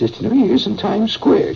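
high, lightly voiced grandmother; sing-song imitation of grandma; a hint of a prim grandmother; mock grandmother. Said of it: "She didn't talk like that".

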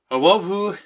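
put-on high, loud, babyish slur; gushy imitation of a drugged declaration; Amos, loopy. Drugged brother tells sister he loves her, loopy. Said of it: "Amos goes".

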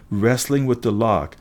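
ordinary delivery

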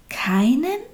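KEINEN rising high in disbelief; disbelieving question. A narrator's reading. Little soul to God, hardly believing it, incredulous KEINEN. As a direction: ask KEINEN as if hardly believing it, pitch climbing. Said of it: "konnte kaum glauben, was Gott da sagte".

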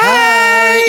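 high loud burst of hello; shouted greeting. Real, not acted.

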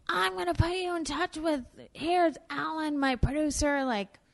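imitating an effusive boss; gushing star voice. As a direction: declare it with big affection. Roseanne promising a part.

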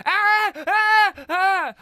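very high, loud repeated shrieks; mock high-pitched screams; imitated scream. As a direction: shriek in bursts, mimicking a woman screaming. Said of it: "I hear Katie going".

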